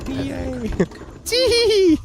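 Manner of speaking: high squealing glee; gleeful. Acted. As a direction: squeal like a child at play. Playing on a playground.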